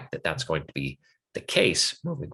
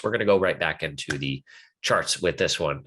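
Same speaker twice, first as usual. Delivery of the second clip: ordinary delivery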